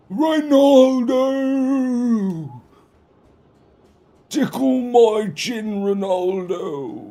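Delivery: high, slow, hammed-up fan swooning over Ronaldo; mocking soccer fan. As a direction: stretch it out in a silly high swoon. Mocking soccer fans' chant.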